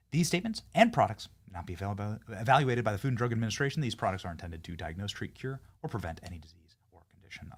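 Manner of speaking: fast, dropping to a whisper at the end; rushed disclaimer. Acted. Host tacks legal fine print onto the ad, rushed fading disclaimer. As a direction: race through the fine print and fade out.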